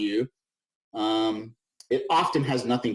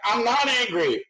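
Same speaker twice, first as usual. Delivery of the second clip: much higher and louder, hammed-up indignant yell; mock angry outburst; 10 semitones higher, 4 dB louder than usual. Presenter jokingly acts out getting angry at her team. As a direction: get worked up and boil over for a laugh. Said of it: "my passionate voice often sounds like my angry voice".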